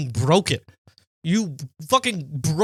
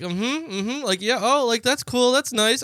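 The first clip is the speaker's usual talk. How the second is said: nod along in a higher voice, feigning interest; mock polite interest; 7 semitones higher, slower than usual. Humoring a girl who leans in talking, mock polite interest.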